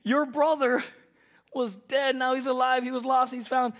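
high, loud declaring; father telling older son; fervent father. Retold or imitated. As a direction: preach it with full force.

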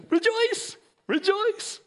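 "rejoice" stretched slow and very high, mock-giddy; goofy caricature. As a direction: parody of a clueless cheerful believer, drawn out and high. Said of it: "goofy caricature".

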